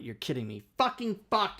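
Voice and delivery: ordinary talk, nothing marked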